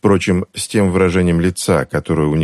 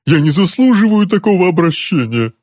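high-pitched wailing protest; anguished, strained high whine; howling; 13 semitones higher, 4 dB louder than usual. A narrator's reading. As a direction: wail it in self-pity. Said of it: "провыл дядюшка".

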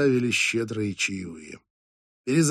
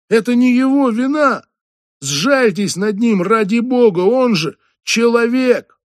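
much higher, loud pleading outcry; impassioned shout; 9 semitones higher, 7 dB louder than usual. Acted. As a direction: defend him against the whole room. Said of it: "Я вскочил и крикнул".

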